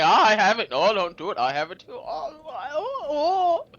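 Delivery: loud, high mock-panicked whining; hammed-up fretting, rising into high oohs. Nations bickering over having the nuke, mock fretting.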